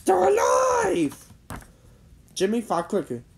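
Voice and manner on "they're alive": high, drawn-out loud shout; horrified shout. A narrator's reading. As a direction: shout it slowly like a monster-movie revelation. Jimmy's horrified cry about pants.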